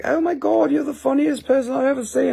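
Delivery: high, gushing fan voice. Retold or imitated. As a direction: squeal it like an excited fan. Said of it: "some of the people are like".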